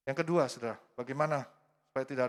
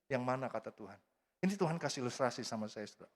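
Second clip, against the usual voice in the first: ordinary delivery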